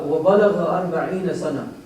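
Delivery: recited Quran verse; Quran recitation. Acted. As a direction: recite the verse in a rolling chant.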